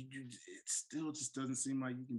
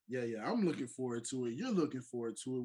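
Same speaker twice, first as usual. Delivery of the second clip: ordinary delivery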